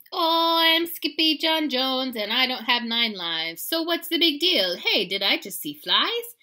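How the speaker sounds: loud, high, exuberant boast; boastful kitty boy. A narrator's reading. Kitty bouncing on his bed, boasting loudly. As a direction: crow it out like an excited kid.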